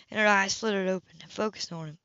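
ordinary delivery

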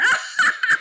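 high, loud guffaw; shouting laughter. A narrator's reading. Boys mocking Henry's watchdog, shouting laughter. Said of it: "began to shout with laughter".

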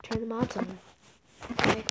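normal talking voice

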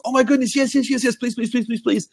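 high, gushing fan squeal; excited security guard meeting a famous YouTuber; starstruck fan. Retold or imitated. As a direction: gush like a starstruck fan meeting his idol. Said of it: "And the guy goes".

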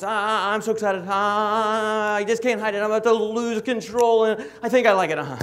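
high, hurried, giddy dog voice; frantic excited theme song. Acted. Young dog's theme song all over the place, overexcited. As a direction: rattle it off high in a giddy rush. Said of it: "his theme song is more like this".

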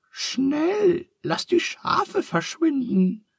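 alarmed cry to hurry; startled. A narrator's reading. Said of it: "sagt Charles erschrocken". (Charles ruft erschrocken und viel höher)

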